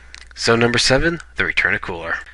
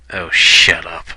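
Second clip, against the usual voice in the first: lower, mock-annoyed retort; 5 semitones lower, 4 dB louder, slower than usual. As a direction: imitate a fed-up cartoon character, pitched down. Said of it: "And just so annoyed".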